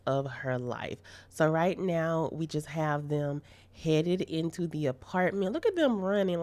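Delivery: loud, slow hyped-up vlog narration. Real, not acted. Player hyping the viewers up for the next surprise, loudly. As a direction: announce it loudly, building hype.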